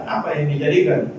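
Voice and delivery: usual speaking voice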